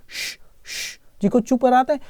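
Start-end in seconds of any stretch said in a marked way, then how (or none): 0.1-1.0 श, श hissed unvoiced like hushing someone; the sh sound alone, a soft hush; sh sound demo. Shown as a demonstration. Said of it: "शंख का वाला sound निकलता है".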